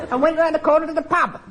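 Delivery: high squawky parrot voice. Acted. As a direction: talk like a parrot. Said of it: "I'm gonna keep this parrot voice up".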